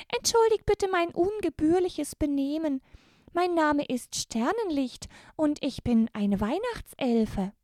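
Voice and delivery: very high, squeaky elf voice; Christmas elf. Acted. The elf Sternenlicht greets the brave girls, very high elfin voice. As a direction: greet them as a tiny, courtly elf.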